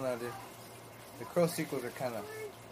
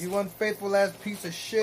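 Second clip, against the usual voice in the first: higher, louder outraged yell; angry; 6 semitones higher, 4 dB louder than usual. Real, not acted. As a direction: scold the cheater on screen. Yelling at cheating movie character.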